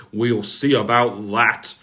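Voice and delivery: loud, firm scolding; the Fat Controller scolding the engines, loud and severe. A narrator's reading. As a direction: lay down the law loudly. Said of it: "said the Fat Controller severely".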